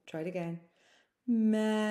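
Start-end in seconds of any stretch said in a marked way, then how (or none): 1.3-1.9 Melon held out long and steady. Shown as a demonstration. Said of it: "It's a long one".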